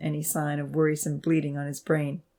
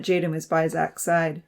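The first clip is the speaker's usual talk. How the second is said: ordinary delivery, nothing marked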